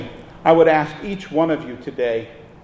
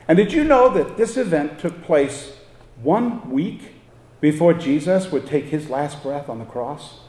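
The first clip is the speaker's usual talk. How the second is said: ordinary talk, nothing marked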